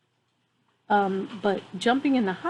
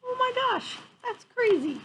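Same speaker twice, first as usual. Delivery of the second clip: amazed; 13 semitones higher than usual. Real, not acted. Reacting to the otter story.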